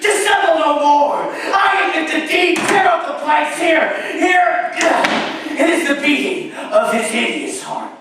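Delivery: loud, high, fast screaming frenzy; hysterical confession. Acted. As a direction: break down and confess it all.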